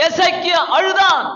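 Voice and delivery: loud, high shouted line; preacher shouting to the congregation; preaching shout. Acted. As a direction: shout it out at the climax. Preacher shouts, climax of story.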